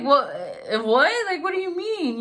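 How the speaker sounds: high, stunned what, what; shocked disbelief. Retold or imitated. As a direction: replay the jolt of hearing it's not him. Shocked hearing photo is fake.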